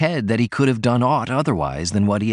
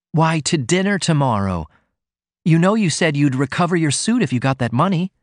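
put-on higher, gently reminding young woman; lightly voiced sweetheart; 7 semitones higher than usual. A narrator's reading. Sweetheart reminding writer of his promise, a touch higher.

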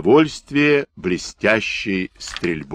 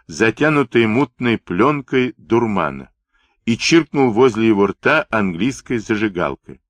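ordinary delivery, nothing marked

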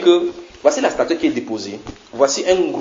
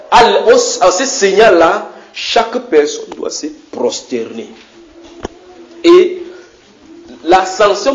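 higher, louder preacher's proclamation; preaching at full force; 5 semitones higher, 4 dB louder than usual. Acted. Preacher proclaiming the king's decree to the congregation, fervent. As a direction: build the decree to a ringing climax.

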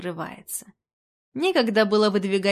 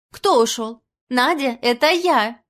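loud, higher-pitched call down the line; shout into phone; 3 semitones higher, 6 dB louder than usual. A narrator's reading. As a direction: call out to a friend who mistook her. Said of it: "крикнула в трубку Люся Симакова". (крик в трубку)

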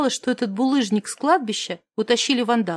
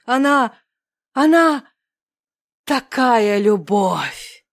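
громче, затем очень медленно «такая любовь»; растяни финал в экстазе; пафосный финал; 4 dB louder, much slower than usual. Acted. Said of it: "Он взялся за пафосные речи". (напыщенно, с нарастанием)

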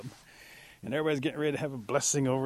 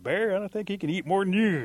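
high, loud teasing call; teasing challenge; 8 semitones higher, 6 dB louder than usual. Acted. Animal to bear about the little one's appetite, teasing loudly. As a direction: call the big eater out.

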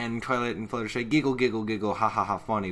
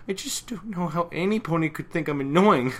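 prissy Pinkie voice; 8 semitones higher, 5 dB louder than usual. Retold or imitated.

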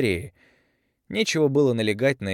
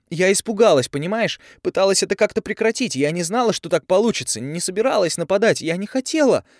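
desperate shout; 10 semitones higher, 7 dB louder than usual. A narrator's reading. Tavi desperately justifying herself.

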